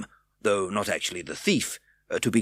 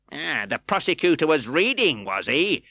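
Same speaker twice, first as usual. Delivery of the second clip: insinuating; 8 semitones higher, 6 dB louder than usual. A narrator's reading. Fang pouncing.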